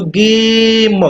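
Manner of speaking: गेम said slowly with a long ay and no final ee; teacher showing गेम with the e silent; long vowel, silent e. Shown as a demonstration. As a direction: draw out गेम and stop dead on the m. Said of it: "ई last में silent हो जाएगा".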